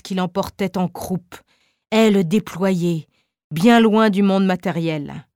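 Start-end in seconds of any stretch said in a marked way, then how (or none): none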